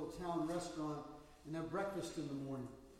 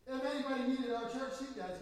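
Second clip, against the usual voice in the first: firm defense; 7 semitones higher, 6 dB louder than usual. Retold or imitated. Pastor defends the man.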